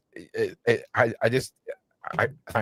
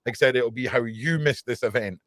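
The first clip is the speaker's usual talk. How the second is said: usual speaking voice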